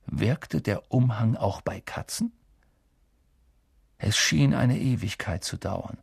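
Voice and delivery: ordinary delivery, nothing marked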